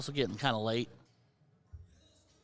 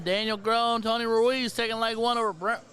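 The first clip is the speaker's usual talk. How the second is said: excited call; 7 semitones higher, 3 dB louder than usual. Real, not acted. Leg won, commentator calls result.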